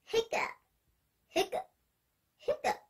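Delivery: high breathy hiccup noises; mock hiccups. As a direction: squeak out the hiccups. Class now hiccups.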